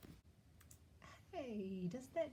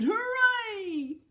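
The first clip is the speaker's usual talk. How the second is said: much higher, much louder cheer; bear cheering; 11 semitones higher, 14 dB louder than usual. Acted. The bear delighted by the picnic, high loud cheer. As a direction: whoop with delight.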